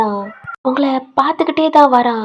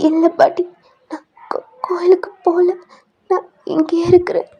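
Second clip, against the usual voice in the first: high, whispery crying voice; acted tearful plea; 6 semitones higher than usual. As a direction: plead through tears, breaking off mid-word. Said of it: "இப்ப எதுக்கு அழுதுகிட்டு இருக்க". (அழுகையோடு தழுதழுத்த குரலில் மறுப்பது)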